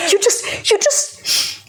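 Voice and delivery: high, squeaky laughing words; helpless laughter. Real, not acted. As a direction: squeeze the words out through laughter. Laughing too hard to finish.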